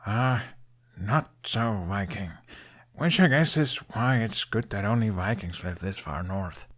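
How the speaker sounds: a hint of young Hiccup joking at his own expense; Hiccup, self-deprecating. Hiccup jokes about himself to Toothless, wry.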